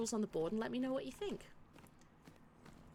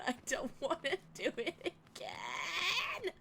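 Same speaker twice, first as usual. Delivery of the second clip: laughing whine; 6 semitones higher, slower than usual. Real, not acted. Whining laughing plea.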